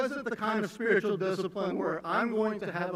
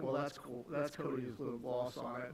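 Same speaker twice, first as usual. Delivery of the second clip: dismissive listener; 6 semitones lower, 10 dB quieter than usual. Pretend. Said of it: "You might be like".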